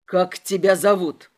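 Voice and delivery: loud, low bark; forceful low-pitched demand; barked question. A narrator's reading. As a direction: bark it like an interrogator. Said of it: "гаркнул мне в лицо инспектор".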